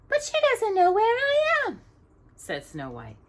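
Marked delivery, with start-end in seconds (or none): 0.1-1.8 glad princess. A narrator's reading.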